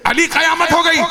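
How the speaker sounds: high, urgent cry of disaster; panicked lamenting call; anguished alarm. Acted. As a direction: cry out the dreadful news. Maid's alarm repeated at full force.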